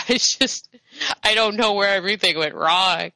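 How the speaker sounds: hammed-up tearful drunk lament; pathetic crying drunk. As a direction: wail your woes like a self-pitying drunk. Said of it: "pathetically drunk and kind of, like, just crying".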